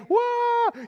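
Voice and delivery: hammed-up frightened yelp; comic shriek of fear; mock shriek. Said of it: "they cried out".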